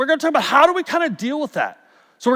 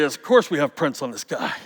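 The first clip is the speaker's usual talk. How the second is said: retold in a mildly arrogant, lowered voice; mock the official's arrogant certainty; 7 semitones lower, 6 dB quieter than usual. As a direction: lower and softer, with a touch of smug certainty. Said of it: "very arrogantly".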